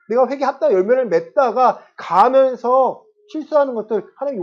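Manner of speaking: loud, high-pitched sermon delivery; impassioned preaching. Real, not acted. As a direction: preach it forcefully, driving each point home. Preaches at full force.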